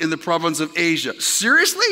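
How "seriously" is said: high-pitched incredulous question; mock disbelief. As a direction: react as if you can't believe the verse. Reacts to the verse just read.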